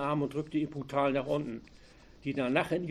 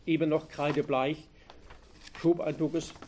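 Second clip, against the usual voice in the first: usual speaking voice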